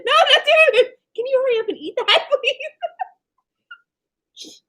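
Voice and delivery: high, loud, rushed begging through laughter; laughing mock plea. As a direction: hurry her up while cracking up. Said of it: "And I said".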